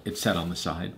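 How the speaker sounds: plain reading voice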